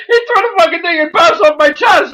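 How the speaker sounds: high, shouted storytelling; excited retelling. Real, not acted. Telling a co-host about the thrown object, excited shout.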